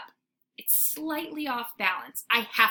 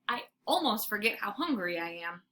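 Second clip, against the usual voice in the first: usual speaking voice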